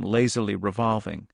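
plain reading voice

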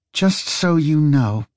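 slow words through clenched teeth; gritted teeth. Acted. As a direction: grit your teeth and speak. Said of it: "she said through gritted teeth".

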